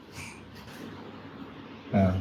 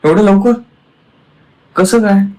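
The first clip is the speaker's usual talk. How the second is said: mock amazement; 9 semitones higher, 10 dB louder than usual. Real, not acted. Amazed it healed quickly.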